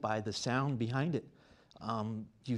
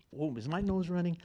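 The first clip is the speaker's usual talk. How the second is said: ordinary talk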